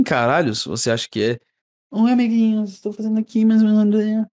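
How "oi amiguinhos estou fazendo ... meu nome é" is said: influencer voice. Pretend. Mocking influencer greeting.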